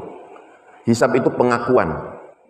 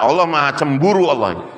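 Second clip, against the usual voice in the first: ordinary talk, nothing marked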